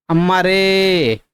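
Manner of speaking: अम्मा रे with रे held very long and slow; drawn-out exclamation. Shown as a demonstration. Teacher showing students a long vowel in अम्मा रे, slowly drawn out. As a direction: pull the final रे out like a wail. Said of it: "खींच रहे हैं".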